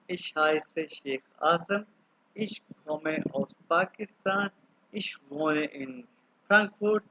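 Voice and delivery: very slow, each German phrase spaced out; slow German demo. Shown as a demonstration. Teacher reading German slowly to an online class. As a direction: spell out the German introduction slowly for beginners. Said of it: "मैं अभी आपके सामने कुछ बोलता हूँ".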